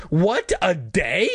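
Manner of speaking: high, drawn-out indignant echo; acted shrill outrage, rising at the end; shrill cry. A narrator's reading. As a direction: repeat it shrilly in disbelief. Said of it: "I cried shrilly".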